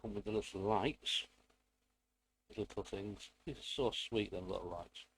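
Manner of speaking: ordinary delivery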